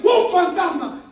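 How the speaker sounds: loud, high, stretched-out shout; shouted cry. Acted. Disciples in the storm spot the figure, shouting out. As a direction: cry out in acted alarm.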